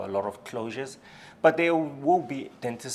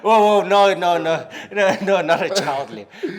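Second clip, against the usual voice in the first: loud, laughing denial; laughing protest; 7 semitones higher, 11 dB louder than usual. Real, not acted. Denying joke, laughing.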